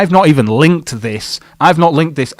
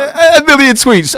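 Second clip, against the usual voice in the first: high, slow, drawn-out mock amazement; mock disbelief; 14 semitones higher, 5 dB louder than usual. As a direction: stretch it out like you can't believe the hype. Mocking the claimed million tweets.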